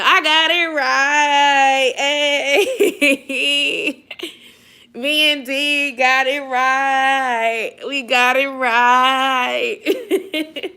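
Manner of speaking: giddy laughing joy. Real, not acted. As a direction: laugh through the words, thrilled. Excited about getting answer right.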